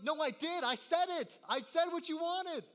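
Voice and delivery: put-on high, loud, whining child insisting he obeyed; whiny kid protest. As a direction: protest like a sulky kid caught out.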